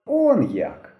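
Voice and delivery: ordinary delivery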